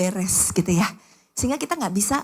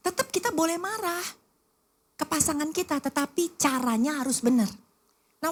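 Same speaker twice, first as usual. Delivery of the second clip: normal talking voice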